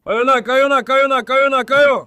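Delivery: loud, high shouts to get the birds flying; shouting at pigeons. Real, not acted. Man on the roof shouting his pigeons into flight, loud. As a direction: yell up to make the flock take off.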